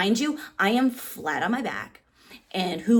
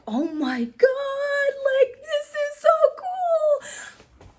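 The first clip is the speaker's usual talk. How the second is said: much higher, very slow, tearful squeal; ecstatic squeal; 4 dB louder, much slower than usual. Retold or imitated. Teen fan holding the belt, slow high tearful squeal. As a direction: squeal close to happy tears. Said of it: "I was just like".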